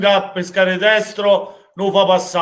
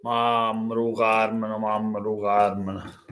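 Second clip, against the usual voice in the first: mock lament; 8 semitones lower, 7 dB quieter, slower than usual. Real, not acted. Fan lamenting, mock wail.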